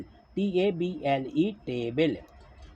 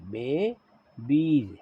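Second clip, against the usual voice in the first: Babies stretched slowly into two long syllables, बे and बीज; very slow बे—बीज for learners writing it; sounding out; much slower than usual. Shown as a demonstration. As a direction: draw out both halves of babies. Teacher sounds out word for writing.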